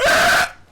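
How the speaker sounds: sudden loud blast of a cough; short explosive cough-like shout; loud demonstrated noise. Shown as a demonstration. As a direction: let out one short, ear-splitting burst. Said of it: "What, you mean that?".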